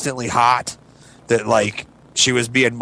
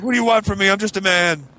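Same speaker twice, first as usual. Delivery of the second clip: defensive whine; 5 semitones higher, faster than usual. Retold or imitated. Defensive husband reply.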